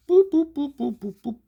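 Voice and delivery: rapid high-pitched boops that tail off; imitated transformation sound. Showing how the transformation just happens, high imitated boops.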